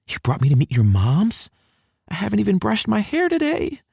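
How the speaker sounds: put-on high-pitched, dismayed girl's voice; indignant girl. A narrator's reading. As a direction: protest in a high female character voice. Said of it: "even if it's from anger".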